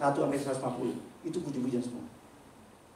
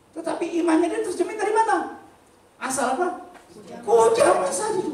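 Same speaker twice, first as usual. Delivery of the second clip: loud, high preacher's cry to the congregation; fiery preaching; 11 semitones higher, 7 dB louder than usual. Acted. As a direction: thunder it out like a revival preacher. Preaching at full force.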